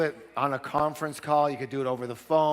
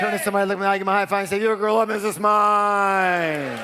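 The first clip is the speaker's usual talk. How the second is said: loud, higher rally cry; hyped; 6 semitones higher, 8 dB louder than usual. Real, not acted. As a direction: pump up the crowd. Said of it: "cheering instruction".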